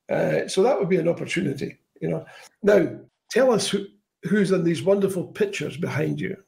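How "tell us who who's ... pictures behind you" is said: ordinary talk, nothing marked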